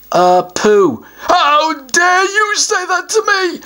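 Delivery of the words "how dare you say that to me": higher troll voice, mildly affronted; a troll pretending to be insulted; offended troll. Troll mildly affronted by the answer Pooh.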